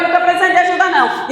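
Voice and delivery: fast and loud, cutting her off; quick loud brush-off. Retold or imitated. Rejecting the spirit's offer of help, a fast loud brush-off. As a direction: rattle it off loud to shut her down.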